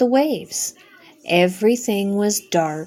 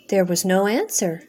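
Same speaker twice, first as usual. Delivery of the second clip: ordinary delivery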